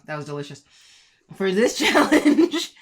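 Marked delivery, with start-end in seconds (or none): 1.3-2.7 laughing. Real, not acted. Laughing while announcing challenge.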